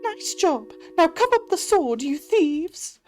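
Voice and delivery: very high, bossy little mouse; tiny mouse scolding thieves; squeaky mouse ordering the thieves. Acted.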